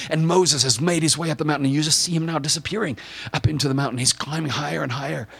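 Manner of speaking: high, impassioned narration; dramatic preaching. Acted. Preacher watching Moses climb toward God, impassioned and urgent. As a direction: build with awe and yearning. Preacher builds as earth shakes.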